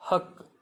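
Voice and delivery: teacher repeats हक clearly; model word हक. Shown as a demonstration. Teacher modeling हक for learners, clear. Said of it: "H U के".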